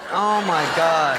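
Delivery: slow, loud exasperated groan of words; mock dismay. Viewer hearing her say my fiance died, hammed-up dismay. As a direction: draw it out in exaggerated disbelief.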